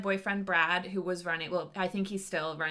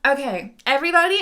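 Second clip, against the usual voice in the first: calling out; 7 semitones higher, 11 dB louder than usual. Acted. Cheerleader calling the cafeteria.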